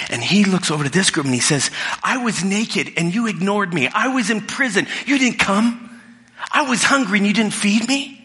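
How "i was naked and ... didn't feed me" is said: high, rising, accusing voice of the king; rebuking king. Acted. King in Jesus's story accusing the group who ignored him, higher and indignant. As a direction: lift the pitch and press each charge.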